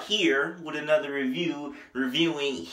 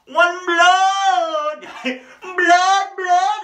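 high, loud, slow sung ad-lib; 7 dB louder, much slower than usual. Retold or imitated.

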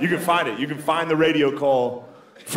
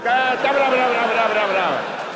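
mock foreign babble; 9 semitones higher, faster than usual. Comic mocking a foreigner's babble, pitched up.

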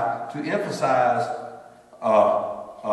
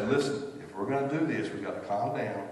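calming a panicked person; 4 semitones lower, 5 dB quieter than usual. Retold or imitated. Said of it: "I just say".

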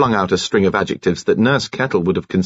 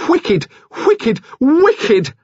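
rising ever higher on each wicked; growing shrill cry; 4 dB louder, much slower than usual. Acted. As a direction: climb in pitch from first to last wicked. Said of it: "Nurse Kettle cried out".

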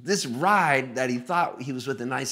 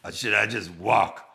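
put-on singer's voice, louder; 5 dB louder than usual. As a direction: imitate the wary passenger, pointedly loud. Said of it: "And then he goes".

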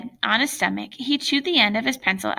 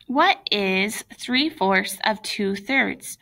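slow puzzled reading; much slower than usual. Acted.

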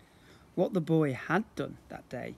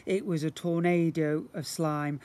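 plain reading voice

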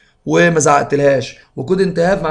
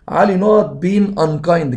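slow dictation; 2 semitones higher, much slower than usual. Shown as a demonstration. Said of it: "teacher reads exam sentence".

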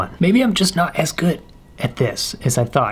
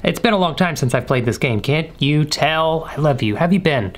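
usual speaking voice

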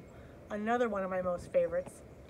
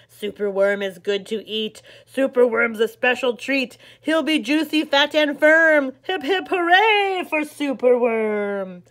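dreadful croak; 3 semitones higher, 12 dB louder than usual. Acted. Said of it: "listen to his dreadful croak".

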